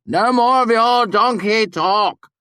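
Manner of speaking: very high, loud, drawn-out protest; amused shout. A narrator's reading. Simon objects to his friends' foreign talk, amused shout. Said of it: "Simon shouted, his voice bubbling with amusement".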